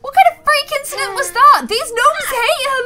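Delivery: high and loud disbelief; outraged at a freak accident; shocked outburst. Real, not acted. Gamer stunned by the freak lightning strike, high and loud.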